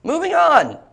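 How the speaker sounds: high, loud, with a cracking voice. Real, not acted. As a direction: let the voice crack on the word on. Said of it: "Do it with your voice cracking just like that".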